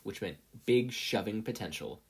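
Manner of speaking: ordinary delivery, nothing marked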